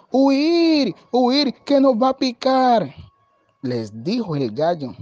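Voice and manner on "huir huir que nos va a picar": high, stretched cry of alarm; frightened goats. A narrator's reading. Goats running from a fierce rooster, crying out in fright. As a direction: cry out to flee, high and urgent. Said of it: "se asustaron".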